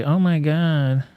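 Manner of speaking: high, slow, hammed-up mock of a victim; mocking whiny voice. Streamer mocks the man's claimed story, high sneering parody voice. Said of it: "they all tried to say".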